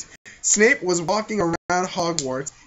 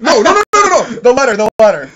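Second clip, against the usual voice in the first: louder, higher laughing no's; laughing mock despair; 5 semitones higher, 11 dB louder, faster than usual. Real, not acted. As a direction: wail it in fake agony, laughing. Mock anguish at chapter title.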